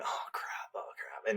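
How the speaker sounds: panicked whisper under the breath. Retold or imitated. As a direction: whisper it like your heart is pounding. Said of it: "and I'm going".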